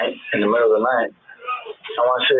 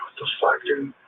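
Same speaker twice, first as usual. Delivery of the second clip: under-the-breath what the fuck; whispered disbelief; 6 dB quieter than usual. Retold or imitated. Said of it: "I'm like".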